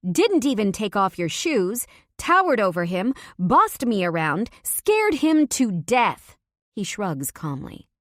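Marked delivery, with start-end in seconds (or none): 0.1-6.4 loud and high, building accusations, slowing at the end; angry tirade. A narrator's reading. Wife scolding husband for scaring her friend. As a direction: lay into him point by point.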